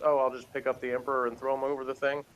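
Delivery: usual speaking voice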